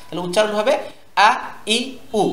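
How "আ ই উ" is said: "আ ই উ" cut short and sharp, each separate; "আ ই উ" with no lengthening; sharp short vowels. Shown as a demonstration. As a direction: say "আ", "ই", "উ" as crisp short bursts. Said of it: "উচ্চারণটা একটু তীক্ষ্ণ করতে হবে".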